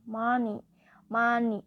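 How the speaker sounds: MO-ney, first syllable loud, falling away; first-syllable stress. Shown as a demonstration. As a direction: hit the first syllable of Money hard. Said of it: "Stress will fall on the first syllable".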